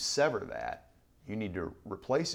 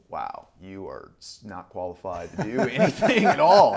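mock dismissive rejection, laughing; 15 dB louder than usual. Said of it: "And they were like".

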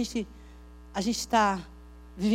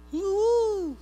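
victory whoop; 10 semitones higher, 5 dB louder than usual. Pretend. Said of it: "celebrating victory".

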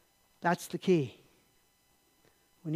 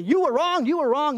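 loud, higher-pitched accusation; accusing enemy; 6 semitones higher, 6 dB louder than usual. Acted. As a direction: hammer the accusation like a taunting enemy. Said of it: "the enemy is gonna say".

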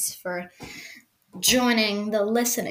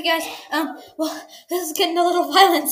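ordinary talk

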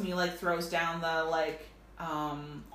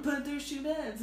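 pitched way up, whining; high whiny voice; 10 semitones higher than usual. Pretend. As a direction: squeak it out in protest. Acted guy whining.

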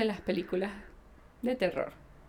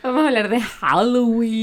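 loud announcement; 11 dB louder than usual. Acted. Corrects to Halloween loudly.

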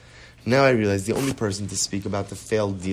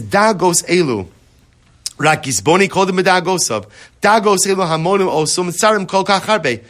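raised, loud recitation of Hebrew; emphatic text reading; 7 semitones higher, 7 dB louder than usual. A narrator's reading. Teacher quoting the Piazetzna's text to listeners, emphatic recitation. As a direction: read the source out high and forcefully. Reads the Hebrew source aloud.

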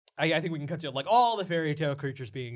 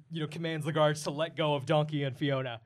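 normal talking voice

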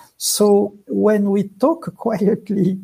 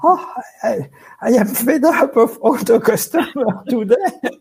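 higher, louder mock grumble with a laugh; mock fed-up; 5 semitones higher, 4 dB louder than usual. Acting out someone sick of the customers, mock fed-up. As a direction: playfully act out being fed up.

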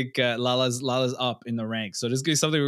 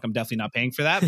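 fast aside; much faster than usual. Retold or imitated. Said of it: "then I'm like".

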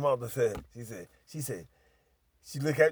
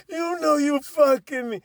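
high, loud imitation of the girl; mock accusation; 12 semitones higher, 7 dB louder than usual. Girl accusing him before the mother, mock indignant voice. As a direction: act out her heated accusation.

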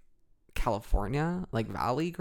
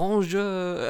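higher, put-on French greeting; affected French; 6 semitones higher, 4 dB louder than usual. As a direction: show off a mock French accent. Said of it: "they're like".

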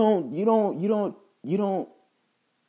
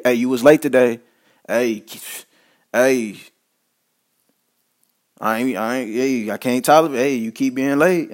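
scolding boss; 4 dB louder than usual. Retold or imitated. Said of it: "when he even talking negative".

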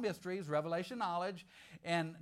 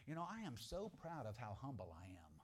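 smug friend; 7 semitones lower, 12 dB quieter than usual. Retold or imitated. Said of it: "he says stuff like this".

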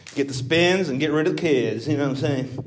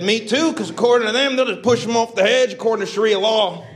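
higher, louder mock retort; quoted self; 9 semitones higher, 4 dB louder than usual. Retelling his reply to friends, a higher louder quip. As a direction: fire back the punchline. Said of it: "I said".

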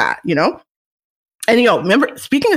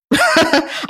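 sudden high laugh at her own slip; bright embarrassed laugh; high burst of laughter; 9 semitones higher than usual. Real, not acted. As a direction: laugh at yourself, pitched high.

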